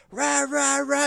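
high loud mock rant noise; a hammed-up angry blather. Angry customer ranting at staff, mock high blather.

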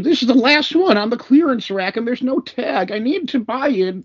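high whiny imitation of a customer; mocking customer voice. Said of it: "She's like".

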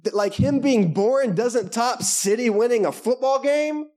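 high, drawn-out disbelief; hammed-up incredulity; mock incredulous.

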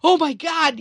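high, loud mock shout; alarmed dad. Dad walks in on his bleeding son, shouting in alarm. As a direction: imitate a dad bursting in, alarmed. Retold dad walking in on injury.

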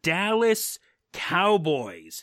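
slow, high, drawn-out announcement of the name; dramatic reveal. Acted. Host unveiling his top power ranking, drawn out dramatically. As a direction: unveil the winner like a grand prize.

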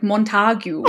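"Montague" with a long drawn-out A; German way of saying it. Shown as a demonstration. Showing a friend how Germans say her surname, drawn-out A. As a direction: stretch the A in "Montague" the way the locals do. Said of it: "Just really elongate the A".

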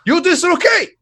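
protesto agudo. Retold or imitated. Protesting doctor grabbing ankle.

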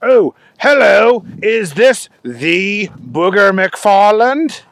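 British caller with drawn-out words; weird British accent. Acted. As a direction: greet him in a put-on British accent. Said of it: "a pretty weird accent".

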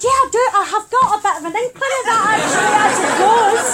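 high, loud, fast exasperation; rapid loud indignant protest. Retold or imitated. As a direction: rattle it off, exasperated. Indignant reply to sister.

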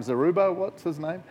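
ordinary delivery

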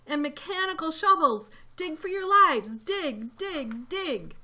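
high shouted order, loudest at first; urgent shout. A narrator's reading. Mister Fox ordering his family to dig, shouted. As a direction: shout it urgently, then punch out each repeat. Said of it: "shouted Mister Fox".

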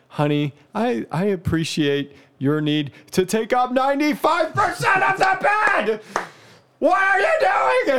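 high, sing-song husband voice building to a high shout; sweetly mock the wife, then blow up. Husband to sprawled wife, sing-song then shouting.

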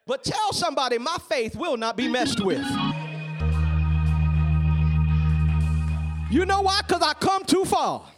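building from steady to shouting climax; rising preacher cadence. Acted. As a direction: climb to a full-force preached peak. Sermon climbing to a climax.

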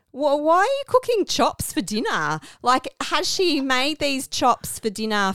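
high, incredulous question. Real, not acted. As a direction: sound baffled by it. Incredulous about the chops.

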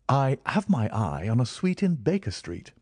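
lightly voiced Holmes.

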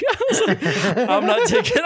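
very high, squealing through laughter; laughing hard. Real, not acted. Storyteller refusing to uncover himself, cracking up. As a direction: barely get the words out for laughing.

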